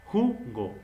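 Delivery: jugo with JU punched high; stress on first syllable. Shown as a demonstration. Teacher showing where the prosody falls, jugo stressed on JU. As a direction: hit JU, then drop on go in jugo. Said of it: "En ju".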